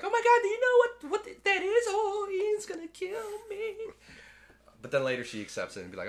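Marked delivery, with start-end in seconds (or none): 0.0-3.7 much higher, squeaky panicked woman voice; mock Julianne Moore. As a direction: shriek it like a panicked scientist. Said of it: "Julianne More's like".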